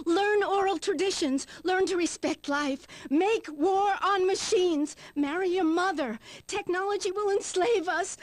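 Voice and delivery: high, mounting zealot's rant; fervent proclamation. Acted. Guest proclaims manifesto, refusing to leave.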